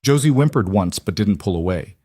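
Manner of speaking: usual speaking voice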